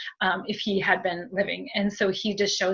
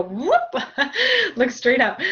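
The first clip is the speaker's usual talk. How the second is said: high laughing whoop, louder; 6 semitones higher, 4 dB louder, slower than usual. Real, not acted. Medium craning up at a tall spirit, laughing. Mimes looking up at tall dad.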